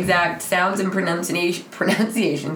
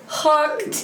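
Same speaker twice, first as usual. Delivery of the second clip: hooked said high through a laugh; laughing at her own joke; 9 semitones higher than usual. Real, not acted. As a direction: giggle it out triumphantly. Fishing for compliment joke.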